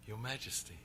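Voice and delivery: soft, low and reverent; quiet, humble address; hushed reverence. Retold or imitated. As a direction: say it softly, as if kneeling before royalty. Kneeling reverently before the Queen.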